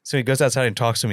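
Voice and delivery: usual speaking voice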